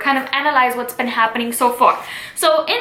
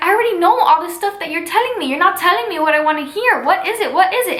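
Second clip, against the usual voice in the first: higher, fast, impatient retelling of Juliet; impatient insistence; 6 semitones higher, faster than usual. Retold or imitated. Juliet pesters the Nurse for news, impatiently insistent. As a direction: press for the answer in a raised, hurried voice. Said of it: "persisting to know now".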